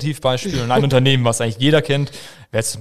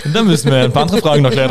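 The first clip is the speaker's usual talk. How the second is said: laughing; 2 semitones higher, 8 dB louder, faster than usual. Real, not acted. Laughs at cheeky request.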